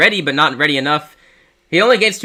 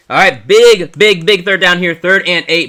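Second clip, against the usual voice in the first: rising excited sports call, louder and higher; live play-by-play; 7 semitones higher, 7 dB louder than usual. Real, not acted. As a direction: call the sack like a broadcaster. Calls third-down sack live.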